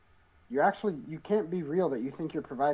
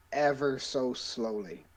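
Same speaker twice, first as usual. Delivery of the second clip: drawn-out slowness; slower than usual. Real, not acted. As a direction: draw out "ever so slowly". Player describes deliberately stalling.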